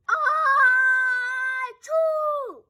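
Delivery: ah-choo, hammed-up; sneeze. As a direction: build into a big sneeze. Sneezing in the story.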